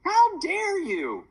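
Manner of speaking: very high, loud, drawn-out mock outrage; feigned shock. A narrator's reading. Mom pretending offence at being called old, feigned shock. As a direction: overplay the shock, stretching each word slowly. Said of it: "Mom feigned shock".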